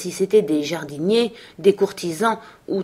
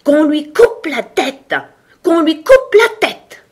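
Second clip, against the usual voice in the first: high, drawn-out furious shriek; loud screamed royal command, stretched out; howling; 10 semitones higher, 7 dB louder, slower than usual. A narrator's reading. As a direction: howl the order like an enraged queen. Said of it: "elle se mit à hurler".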